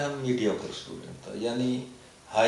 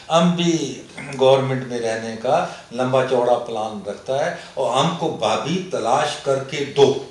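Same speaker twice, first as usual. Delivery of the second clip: put-on loud Pashto-accented comic verse; 8 dB louder, slower than usual. Poet declaiming his Pathani ghazal to the host, Pashto accent. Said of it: "میں پڑھتا بھی اسی لہجے میں تھا".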